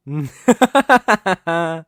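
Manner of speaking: loud, triumphant villain laugh. Acted. Villain revealing the stolen ledger, a loud triumphant laugh. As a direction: revel in the final blow.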